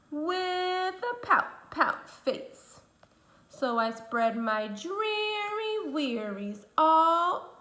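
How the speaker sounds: slow, drawn-out sad moan; gloomy fish refrain. Acted. The pout pout fish tells the sea his gloomy refrain, slow and sad. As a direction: moan it out slowly like a sulky fish.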